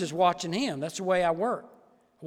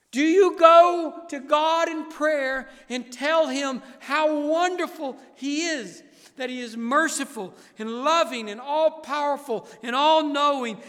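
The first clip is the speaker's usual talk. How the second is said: loud, slow, emphatic preaching; full-force preaching; 8 semitones higher, 9 dB louder than usual. Acted. Pastor urging the congregation to worship, loud slow preaching. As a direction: lift every word to the rafters.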